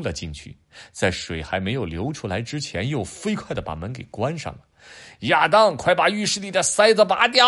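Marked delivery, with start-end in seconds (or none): 5.3-7.4 high, loud frantic shout; urgent yell. Acted. Dad yelling at Adam in the bath, loud urgent shout. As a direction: yell through the door to stop the flood.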